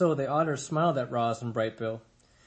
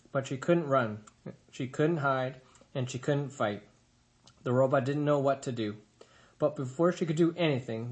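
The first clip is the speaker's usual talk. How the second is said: ordinary delivery, nothing marked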